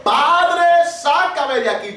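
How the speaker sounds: high cry on Padre, then slow pleading; reliving the drowning cry to the Father; retold desperate cry. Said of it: "No, yo grité".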